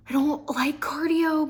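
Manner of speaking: hushed confession of hating cardio; whisper. Real, not acted. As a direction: whisper it like a guilty secret. Confessing dislike of running.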